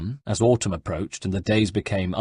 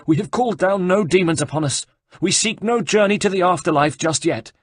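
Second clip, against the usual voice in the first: frightened abbot; 9 semitones higher, 4 dB louder than usual. Acted. Abbot fearfully protests.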